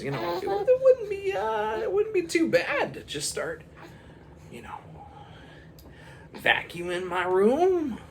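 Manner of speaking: put-on a little boy dreaming up a wish; kid voice musing.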